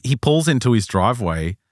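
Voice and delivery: ordinary talk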